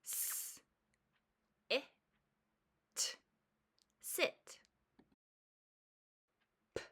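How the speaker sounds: letter sound, then "it", then blended "sit". Shown as a demonstration. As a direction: split each word into onset and "it", then blend. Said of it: "sounding out onset and rime".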